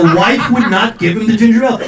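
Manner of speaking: ordinary delivery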